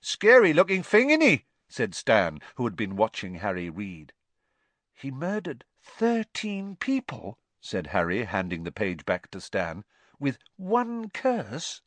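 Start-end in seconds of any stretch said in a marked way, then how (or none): 0.0-1.5 a high, loud, chatty voice; cheeky Cockney conductor. A narrator's reading. Stan the conductor speaks to Harry. 4.9-7.4 put-on a higher, slow, serious boy; boy's voice. A narrator's reading. Harry answers Stan. 10.2-11.8 put-on a higher, slow, serious boy; boy's voice. A narrator's reading. Harry finishes his sentence.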